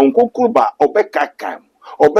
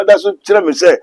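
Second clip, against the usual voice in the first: normal talking voice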